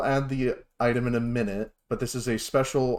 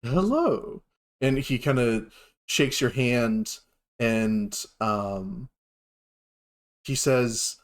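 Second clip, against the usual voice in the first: normal talking voice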